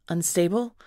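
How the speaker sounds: usual speaking voice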